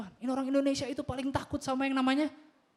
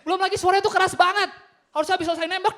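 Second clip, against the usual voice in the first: ordinary talk, nothing marked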